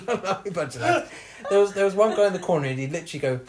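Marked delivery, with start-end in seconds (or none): 0.1-1.1 laughing. Real, not acted. Laughing while recalling ward mates.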